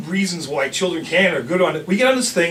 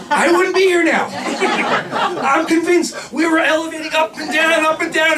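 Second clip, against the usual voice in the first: laughing; 10 semitones higher, slower than usual. Real, not acted. Laughing at the wild coaster ride.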